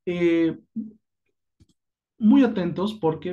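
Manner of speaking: normal talking voice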